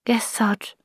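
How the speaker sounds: timid, whispered reply; meek whisper. Acted. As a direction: answer meekly under your breath. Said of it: "said Polly meekly".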